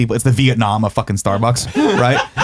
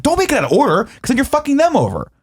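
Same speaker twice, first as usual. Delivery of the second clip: irate; 12 semitones higher than usual. Retold or imitated. Irate customer lecturing manager.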